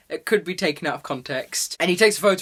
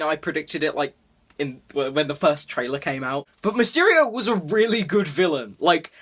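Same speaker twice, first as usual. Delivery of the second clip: ordinary delivery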